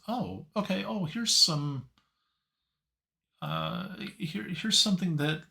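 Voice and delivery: high, eager little gasp of recognition; pleasantly surprised. Retold or imitated. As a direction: voice jumps up in delighted discovery. Imagined reaction to finding pictures.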